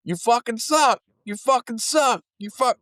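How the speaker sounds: high mocking heckle, repeated; drunk heckler jeer. Drunk guy heckling the band between songs. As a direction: taunt the singer over and over. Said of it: "he'd say it over and over".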